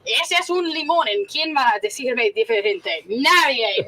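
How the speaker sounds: put-on playful Spanish accent on limón. Answering cohost's accent joke, playful Spanish accent. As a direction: roll into a hammed-up Spanish accent. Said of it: "if you said it with an accent".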